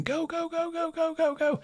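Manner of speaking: high, far-off terrified yell of go go; shrieking to the driver in terror; panicked screaming. Retold or imitated. In the truck bed, begging the driver to go, high panicked screams. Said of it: "we're just screaming".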